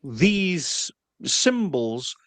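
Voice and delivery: ordinary talk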